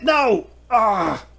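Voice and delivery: high panicked cry out; frightened cry. Acted. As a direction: yelp in fear at being grabbed. Captive grabbed and held up.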